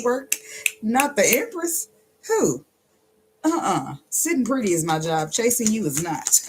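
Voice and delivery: high, sassy, playful diva voice. Pretend. Reader voicing the Empress card, sassy and high. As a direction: toss it off high and haughty like a queen.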